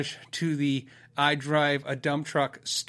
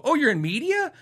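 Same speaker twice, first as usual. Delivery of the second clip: high, rising mimicked question; imitated impressed barista; intrigued surprise; 9 semitones higher than usual. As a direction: perk up with sudden interest. Imitates worker's intrigued follow-up question.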